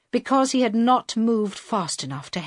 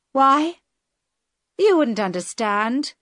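higher, louder scornful brush-off; dismissive girl hijacker; 7 semitones higher, 5 dB louder than usual. Acted. Girl hijacker brushes off Carl's why, scornful. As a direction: wave his question away.

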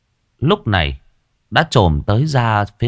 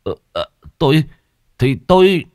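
stammering; 6 semitones higher, 4 dB louder than usual. Acted. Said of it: "ấp úng".

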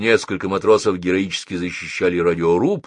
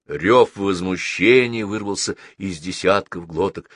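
ordinary delivery, nothing marked

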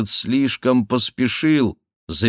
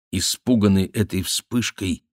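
ordinary delivery

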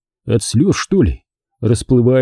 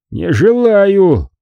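slow, higher stubborn drawl; drunken refusal; 7 semitones higher, much slower than usual. A narrator's reading. Monk refuses again.

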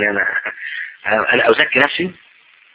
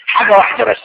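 higher, insistent repeat; higher pitched demand; raised challenge; 8 semitones higher than usual. Acted. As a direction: push the point up high. Pinning him down on one thing.

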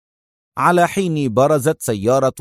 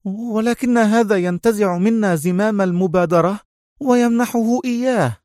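tense; 9 semitones higher than usual. A narrator's reading. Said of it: "قالت في توتر".

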